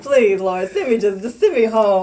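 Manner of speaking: hammed-up begging to be sent home; mock pleading. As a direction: plead to the Lord with comic desperation. Retold plea to God while running.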